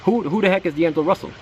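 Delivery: high, incredulous repeat of the question; baffled disbelief. Retold or imitated. Fan recalling draft-day shock at the pick, baffled high. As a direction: act out the stunned fan, pitch way up. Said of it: "That was my thought".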